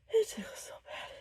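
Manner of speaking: breathless whisper. Real, not acted. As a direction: whisper it, holding back a sneeze. Fighting off a sneeze.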